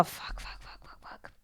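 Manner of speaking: rapid whispered string of curses; voiceless, panicky muttering; whispered cursing. Retold or imitated. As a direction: whisper it over and over, fading away. Retold panicked reaction under the breath.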